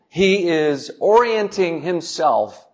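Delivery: ordinary talk, nothing marked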